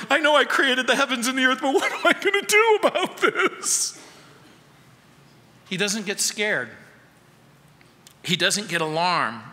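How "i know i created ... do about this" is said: fast, high mock fretting; hammed-up worried Jesus; a panicking God in heaven, mock fretting; mock panic. Imagined panicking Jesus quoted.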